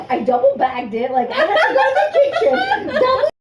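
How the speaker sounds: high, loud, laughing retelling; laughing while quoting. Retold or imitated. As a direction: push the words out through laughter. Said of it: "And he's like".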